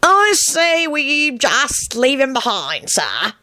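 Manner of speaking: very slow, high-pitched croak; long-drawn croaky character voice. A narrator's reading. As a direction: croak it out, drawing out each word. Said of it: "croaked out the long-limbed Dillwiggle".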